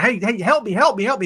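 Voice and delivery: mildly hammed-up call for rescue; retold hollering. Man stuck in hole hollers to passersby, mock urgency. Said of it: "he's hollering".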